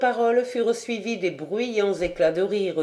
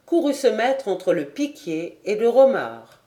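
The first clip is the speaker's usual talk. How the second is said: ordinary delivery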